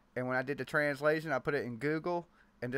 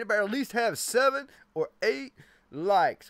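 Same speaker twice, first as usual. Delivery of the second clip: barbarian; 8 semitones higher, 6 dB louder than usual. Acted. Demanding likes, playful threat.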